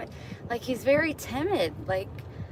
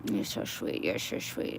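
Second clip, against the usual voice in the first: whispered coo; 7 dB quieter than usual. Real, not acted. Whispering fondly to the cat. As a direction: breathe it to the kitty.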